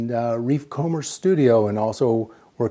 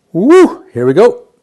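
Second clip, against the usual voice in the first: excited cheer; 8 semitones higher, 15 dB louder, slower than usual. Real, not acted. Kicking off the presentation.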